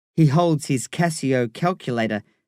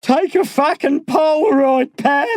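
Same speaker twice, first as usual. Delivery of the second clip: high, loud bark with effort behind it; barked, wheezing; 13 semitones higher, 6 dB louder than usual. A narrator's reading. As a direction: bark it at a gawking neighbour, straining for breath. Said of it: "he barks, wheezing with the effort".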